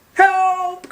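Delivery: very high, loud panicked yelp; cat crying for help. Acted. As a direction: yowl it like a scared cat. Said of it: "cried the cats".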